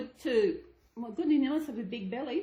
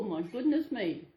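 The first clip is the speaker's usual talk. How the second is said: usual speaking voice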